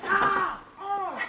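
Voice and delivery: high, soft cries of shock; pained yelps. Real, not acted. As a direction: yelp twice as the zap hits. Shocked by the lead again.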